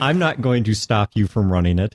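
measured, fully worded second attempt; careful retry. Real, not acted. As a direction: say it deliberately, word by word, correcting the slip. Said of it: "Let me try that again".

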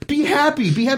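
put-on high, pleading voice of the boy hero; mock pleading hero. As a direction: ham up the boy begging his horse. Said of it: "And he's saying".

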